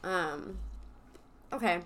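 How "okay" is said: ordinary delivery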